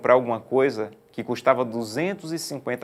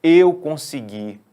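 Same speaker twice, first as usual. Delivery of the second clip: proud boast; 4 semitones higher, 6 dB louder, much slower than usual. Retold or imitated. Proud boast quoted in sermon.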